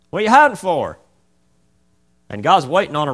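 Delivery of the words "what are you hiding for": high, loud scolding question; scolding mom. Retold or imitated. Mother scolding Johnny for hiding, loud. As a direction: demand an answer from a child.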